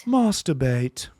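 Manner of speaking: slow British "Masturbate", mahss-tuh-bate; British accent. Shown as a demonstration. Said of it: "in a British accent".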